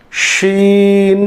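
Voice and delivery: very slow, stretched শিন; drawn-out letter name. Shown as a demonstration. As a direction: hold শিন long for the students to copy. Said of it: "teacher drawing out letter name".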